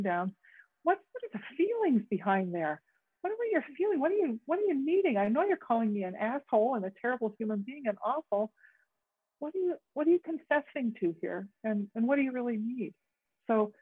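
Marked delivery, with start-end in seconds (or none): 0.8-5.2 much higher, curious questions; curious questioning. Shown as a demonstration. Facilitator modelling curious questions to someone angry, high. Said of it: "get really curious". 9.4-12.9 higher, curious and probing; curious questioning. Shown as a demonstration. Facilitator modelling curiosity toward an accuser, higher. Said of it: "get really curious".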